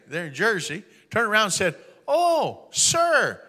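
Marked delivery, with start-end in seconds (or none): 2.1-3.4 much higher, surprised "Oh, sir"; startled fawning. Retold or imitated. Factory manager caught off guard by the owner, startled and high. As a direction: gasp it out in surprise.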